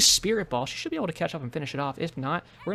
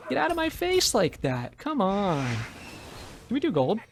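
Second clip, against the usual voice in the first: excited taunt; 6 semitones higher, slower than usual. Real, not acted. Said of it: "I got really excited there".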